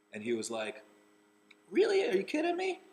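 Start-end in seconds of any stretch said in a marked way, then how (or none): 1.7-2.8 much higher, loud astonished cry; amazed disbelief. Retold or imitated. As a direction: squeal it in total disbelief. Charlie's surprised reply, retold.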